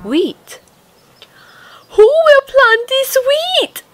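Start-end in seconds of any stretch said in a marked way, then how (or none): 1.9-3.8 high, loud hen voice calling out; hen crying out. A narrator's reading. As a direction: call out like a busy little hen. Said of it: "cried the little red hen".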